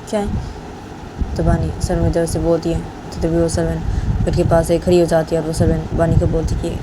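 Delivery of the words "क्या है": hushed whisper, much softer and slow; whispered question. Retold or imitated. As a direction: breathe it out so no one hears. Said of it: "तो बानी servant को बोलती है".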